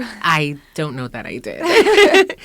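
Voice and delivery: slow, loud answer through laughter; laughing. Real, not acted. Asked when she slept, answering with a loud laugh. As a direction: laugh the words out at your own exhaustion.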